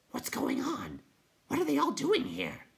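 high-pitched hooting creature voice; astonished night hob. Acted. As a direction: ask in high, wondering hoots.

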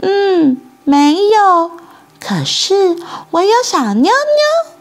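put-on high-pitched girl's answer; surprised little girl. A narrator's reading. Girl looks down and answers the boy, high surprised child voice. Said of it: "萨奇惊讶地看着".

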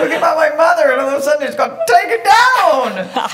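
high and loud through laughter; laughing. Real, not acted. Laughing at hiccup fit.

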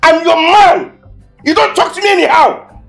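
angry macho threat; furious threat. Acted. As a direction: threaten her with rising fury. Boyfriend threatens girlfriend.

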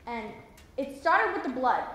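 ordinary talk

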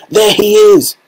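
high-pitched cry from the crowd; excited shout. A narrator's reading. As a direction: call it out in excitement. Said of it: "somebody shouted".

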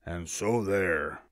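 slow, low bear voice, lightly done; a touch of gruff bear. A narrator's reading. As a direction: end the bear's retort slowly and low. Bear answers the boasting rabbit.

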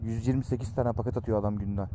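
ordinary talk, nothing marked

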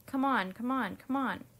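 understated impatient calling out; impatient shout. A narrator's reading. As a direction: hurry them along, lightly voiced. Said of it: "shouted the centipede".

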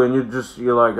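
usual speaking voice

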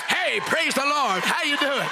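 shouted revival hello; eager churchgoer. Retold or imitated. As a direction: greet them like you're on fire for God. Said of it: "I'm gonna find me someone say".

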